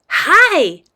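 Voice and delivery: put-on high, loud child's greeting; keen little girl. Girl greets boy's mom, keen high voice. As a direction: pipe up eagerly like a kid.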